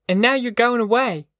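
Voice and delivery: put-on high-pitched, upset girl's voice; accusing protest. A narrator's reading. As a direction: complain high, accusing him. Girl accuses departing boyfriend.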